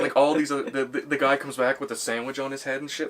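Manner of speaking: normal talking voice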